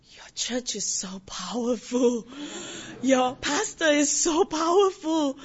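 slow, stretched-out mock amazement; awed praise. Church member voices visitors praising the pastor, awed. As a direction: imitate awe, drawing out each phrase and building.

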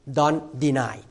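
ordinary delivery

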